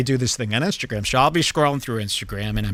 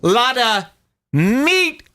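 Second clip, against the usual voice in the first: high-pitched, broken-up exclamation; acted exclamation; 10 semitones higher, slower than usual. Red-blooded guy reacts to a bikini picture, high halting exclamation. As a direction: pitch it way up, pausing between words. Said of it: "And I'll say".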